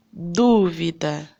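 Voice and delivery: Dúvida slow, with a long, loud DÚ; stress on first syllable. Shown as a demonstration. Teacher giving a proparoxytone example, strong stress on DÚ in Dúvida. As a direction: hit and stretch the DÚ in Dúvida. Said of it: "Qual é a sílaba que a gente fala com mais força".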